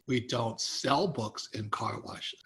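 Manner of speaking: low, slow, quiet deadpan reply; retold refusal. Acted refusal from car wash owner.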